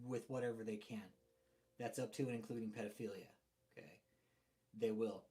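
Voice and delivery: muffled, distant mic sound; like talking down a tube; through a tube. Real, not acted. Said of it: "you're in a tube".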